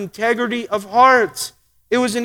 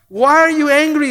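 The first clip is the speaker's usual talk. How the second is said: higher, louder questioning voice of God; God asking; 5 semitones higher, 4 dB louder than usual. Retold or imitated. God confronts Cain, raised and questioning. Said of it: "he says".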